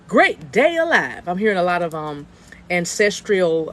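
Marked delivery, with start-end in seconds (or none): 0.1-1.3 higher, slow folksy grandpa voice; old granddad voice. Retold or imitated. Psychic voicing a viewer's grandpa, slow and high. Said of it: "but he said".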